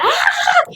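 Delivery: very high mock toddler wail; imitated child's crying scream. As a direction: imitate a little girl crying out. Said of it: "empezó a llorar y a gritar". (grito de llanto infantil imitado)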